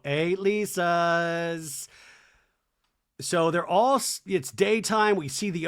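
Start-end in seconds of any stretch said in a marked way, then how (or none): 0.1-1.9 very slow, held-out chant; imitated group chorus. Ladies behind Lisa echoing her, long-drawn mock chorus. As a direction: stretch it like friends echoing in unison. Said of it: "all the ladies behind her go".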